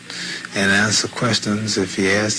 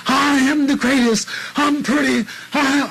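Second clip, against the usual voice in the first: high, loud bragging cry; loud high-pitched boast; boastful shout; 3 dB louder than usual. Retold or imitated. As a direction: crow it like a champion. Ali boasting, acted quote.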